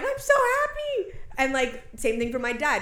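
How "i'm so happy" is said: crying happy. Retold or imitated. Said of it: "starts crying".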